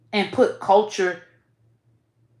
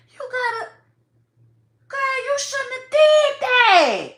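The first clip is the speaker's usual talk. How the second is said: much higher, voice cracking into a cry, slowed; tearful plea; 13 semitones higher than usual. Real, not acted. Vlogger pleads with Nicki about the baby, close to crying. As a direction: plead through tears.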